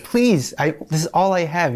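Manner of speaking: high-pitched pleading please, easing down; retold begging. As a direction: plead as if retelling a desperate moment. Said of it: "I begged, I begged him".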